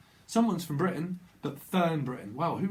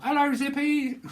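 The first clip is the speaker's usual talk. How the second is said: very high, loud put-on greeting; puppet catchphrase voice; 11 semitones higher, 5 dB louder than usual. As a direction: burst out in a high, silly character voice. Bursts into a puppet catchphrase, then laughs.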